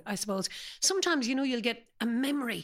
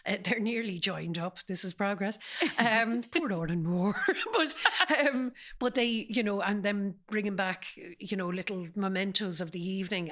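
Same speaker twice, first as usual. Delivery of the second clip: usual speaking voice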